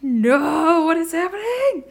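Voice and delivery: high, drawn-out rising wail; mock scared cry. Reader retells seeing the time recur, mock frightened wail. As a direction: wail it in exaggerated dread. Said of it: "It's very scary".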